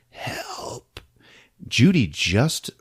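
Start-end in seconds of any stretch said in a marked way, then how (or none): 0.1-1.0 breathy, unvoiced plea; whisper. Injured girl begging Judy, whispered. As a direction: whisper it, barely there. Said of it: "she whispers".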